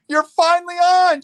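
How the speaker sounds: high, loud and slow with delight; elated greeting to a mother; excited. Retold or imitated. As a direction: cheer that mom is finally on the call. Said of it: "it was just like".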